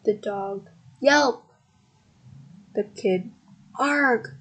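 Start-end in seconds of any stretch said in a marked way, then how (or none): 1.0-1.4 short, higher, louder yelp; dog yelp. A narrator's reading. Dog's tail bitten by the cat, yelping. Dog yelps as all fall. 3.8-4.3 higher storybook cry of alarm; startled cry. A narrator's reading. Kid as the pullers let go, startled. Kid cries out falling over.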